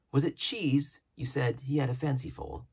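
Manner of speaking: a hint of a curious doctor; doctor's voice. A narrator's reading. Doctor quizzing Jim about cheese, lightly voiced.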